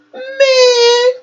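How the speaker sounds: a high, loud "Man," thrown out in disbelief; outraged exclamation. Real, not acted. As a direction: burst out in shock.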